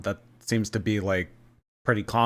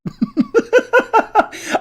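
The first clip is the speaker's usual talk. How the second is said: high squealing giggle, louder; laugh; 6 dB louder than usual. Real, not acted. Streamer giggling at her own thumbnail joke, squealing laugh.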